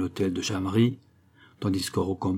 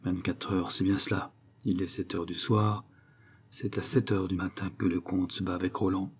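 muttered aside; 2 semitones lower, 6 dB quieter than usual. Acted. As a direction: mutter it under your breath. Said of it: "se dit tout bas".

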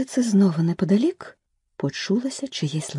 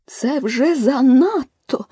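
higher, slow, louder squeak; 8 semitones higher, 5 dB louder, much slower than usual. A narrator's reading. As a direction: squeal it out indignantly. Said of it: "писнула миша". (пискляво, обурено)

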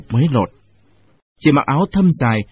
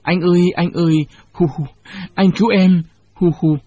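wailing; 5 semitones higher than usual. Acted. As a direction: wail and beg through tears. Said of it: "bù lu bù loa".

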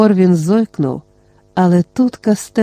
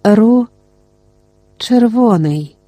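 fading away; 4 semitones higher, slower than usual. A narrator's reading. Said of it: "і ніби почав віддалятися".

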